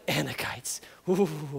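hushed, low name and whispered ooh; dreaded name. Acted. Israelites shuddering at the giants' name, hushed. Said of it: "it would have been like".

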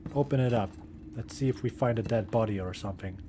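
low, soft spooky voice; hushed. Real, not acted. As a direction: keep it hushed and eerie. Spooky lamp mood, hushed.

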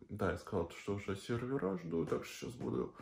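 ordinary delivery

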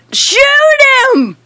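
high, urgent cry; frantic shout. Real, not acted. Gamer orders teammate to fire, frantic high shout.

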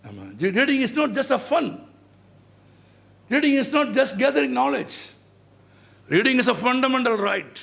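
very slow, emphatic English slogan; measured oratory, drawing out each phrase; slogan declamation. Acted. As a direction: deliver each line slowly, like a proclamation. Recites reading slogans in English.

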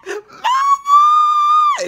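very high, loud screaming for mommy; panicked shout. Retold or imitated. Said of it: "I was like".

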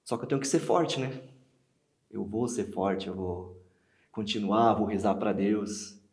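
loud, insistent self-persuasion; loud and desperate, slowing in places; desperate resolve. Acted. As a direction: force out the resolve at full voice. Forcing themself to be strong, acted.

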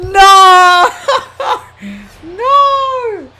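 high, loud, drawn-out no with laughter; laughing wail. Real, not acted. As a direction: wail in disbelief, breaking into laughter. Loses at the last count.

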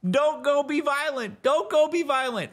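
high, loud pleading to a stubborn hero; high-pitched fan begging Kratos to stay; pleading mock protest. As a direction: beg him not to go, hammed-up. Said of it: "we spent so much time going".

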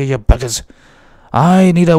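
ordinary delivery

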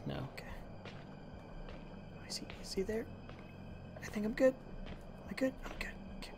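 tense hushed whisper. Real, not acted. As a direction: whisper so the monster won't hear. Hiding from monster, whispering.

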